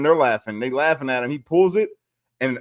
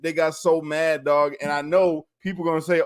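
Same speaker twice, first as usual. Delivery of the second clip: normal talking voice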